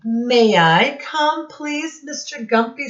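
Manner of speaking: small animal asking. Acted. Animal voice in read story.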